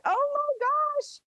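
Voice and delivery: excited. Real, not acted. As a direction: gush with real wonder. Reacting to call from space.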